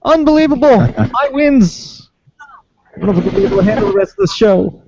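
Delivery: high, frantic reporter in a hurricane; shouting over wind. Pretend. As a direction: yell it out like the storm is howling. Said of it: "pretend that you're in the middle of a hurricane".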